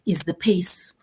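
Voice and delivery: hushed, confiding whisper. Real, not acted. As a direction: lean in and whisper it like a warning. Stresses the danger of rushing.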